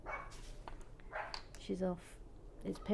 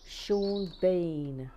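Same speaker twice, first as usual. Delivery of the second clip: Sean Bean impression; 6 dB louder than usual. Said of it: "he's like".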